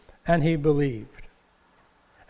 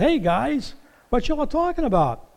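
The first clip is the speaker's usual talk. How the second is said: higher, breezy, innocent greeting; playful Jesus; 9 semitones higher than usual. Retold or imitated. Jesus greets the sad disciples on the road, playfully innocent. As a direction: play dumb and friendly. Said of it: "Jesus was kinda playing".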